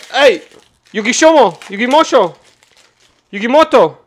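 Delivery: mock angry shout, high and loud; calling out angrily. Streamer pretending to call Bandai to complain, angry shouting. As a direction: holler a half-remembered name across the line.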